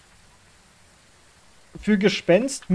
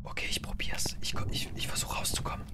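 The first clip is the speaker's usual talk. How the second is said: whisper; 12 dB quieter than usual. Real, not acted. Still hiding from the ghost.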